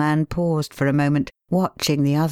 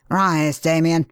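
louder, brisk command; impatient; 2 semitones higher, 3 dB louder, slower than usual. A narrator's reading. King orders the kneeling pale man to rise, impatiently. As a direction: hurry him to his feet. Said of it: "said the king impatiently".

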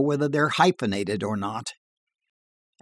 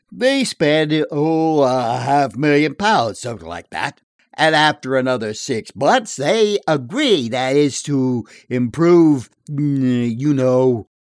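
tall-tale barfly; 2 semitones higher, 5 dB louder than usual. Acted. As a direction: spin a dirty joke in a bar.

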